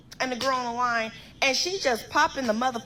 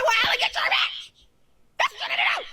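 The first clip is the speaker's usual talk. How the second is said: high-pitched screaming outburst; furious yell; 5 dB louder than usual. Retold or imitated. Yelling at Alan to control his girlfriend, furious yell. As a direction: yell it down the phone, voice way up.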